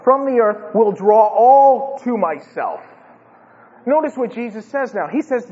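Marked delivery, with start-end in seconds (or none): 0.0-2.8 slow, weighty proclamation building louder and higher; forceful declaration. Acted. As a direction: lay it down as Jesus's solemn announcement. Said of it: "Now he's gonna lay it down".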